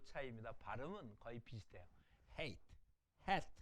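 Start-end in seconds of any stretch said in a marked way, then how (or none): 2.4-3.5 hate with a stronger H than in 해. Shown as a demonstration. Teacher models a second-row letter, hate with stronger H. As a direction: give hate a forceful initial H. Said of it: "해하고 햇은 좀 더 강해요".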